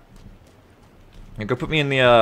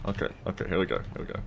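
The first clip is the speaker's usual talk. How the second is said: hushed whisper; 5 semitones lower, 14 dB quieter, faster than usual. Real, not acted. Sneaking tense moment.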